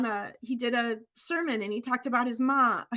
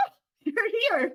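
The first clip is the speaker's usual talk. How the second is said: husband's delight; 8 semitones higher than usual. Retold or imitated. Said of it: "He's like".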